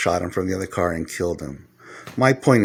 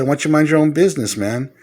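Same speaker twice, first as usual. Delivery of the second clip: retold yell; 7 semitones higher than usual. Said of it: "So I yelled".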